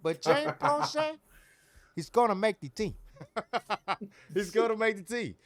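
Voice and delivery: lightly hammed-up Italian gangster; hint of a bad Italian accent. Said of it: "Tony Montana?".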